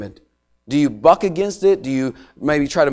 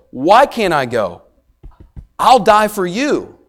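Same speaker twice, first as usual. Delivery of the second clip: louder, higher, slow pleading cry; Peter's impassioned objection, raised and slow; passionate protest; 5 semitones higher, 6 dB louder, slower than usual. Acted. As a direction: protest like a desperate disciple. Preacher voicing Peter's protest.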